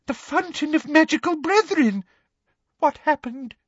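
high, hesitant orders turning plaintive; rattled official, pitched very high; flustered, near whimpering. A narrator's reading. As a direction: dither through orders, climbing to a whimper. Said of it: "he added in a kind of whimper".

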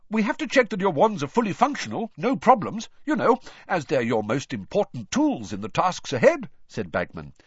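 loud, booming cheerful host; jovial hearty official. A narrator's reading. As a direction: welcome him like a jolly showman. Bagman explaining cheerfully.

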